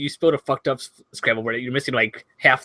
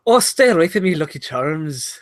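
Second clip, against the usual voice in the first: Irish accent; 3 semitones higher than usual. Shown as a demonstration. Accent guru performing on his co-host's request, Irish accent. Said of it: "let's hear your Irish accent real quick".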